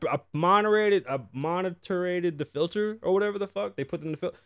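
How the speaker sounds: normal talking voice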